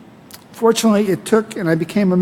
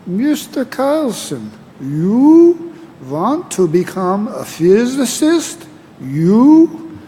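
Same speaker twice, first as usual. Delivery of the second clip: put-on Professor Heller's German accent; 3 semitones higher, much slower than usual. Graduation speaker mimicking his physics professor in a German accent. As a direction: imitate a stern old-school German professor in a German accent, slowly. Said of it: "he said in his German accent".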